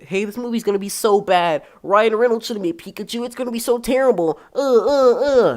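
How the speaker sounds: high, loud mock whining; mocking complainers. People griping about Pikachu movie casting, mocked in high whiny voice. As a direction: imitate naysayers with an exaggerated high, loud whine.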